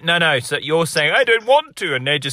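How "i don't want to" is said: higher, mock-pompous echo. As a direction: imitate the pompous line grandly. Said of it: "why do I sound so pompous".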